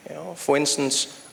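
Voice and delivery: usual speaking voice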